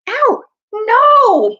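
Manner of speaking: high, loud yelp of protest; bellowing. A narrator's reading. Dwarf hurt by the boy moving, bellowing. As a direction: cry out in pained exasperation. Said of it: "bellowed Trumpkin".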